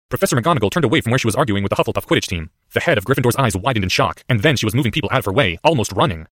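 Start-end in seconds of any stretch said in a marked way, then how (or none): none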